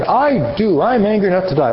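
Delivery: a hammed-up dim-witted prophet; numbskull answer. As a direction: toss it off like a sulking fool. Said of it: "this numbskull answer again".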